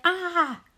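high, loud playful "ah"; mock scream. A narrator's reading. Little brother shows Mom how it went, mock scream. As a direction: demonstrate a scream, hammed up. Boy re-enacts his scream.

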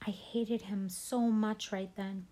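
usual speaking voice

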